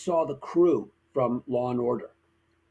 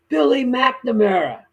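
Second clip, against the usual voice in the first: calling out name; 9 semitones higher, 6 dB louder, slower than usual. Retold or imitated. Crew member calling his name.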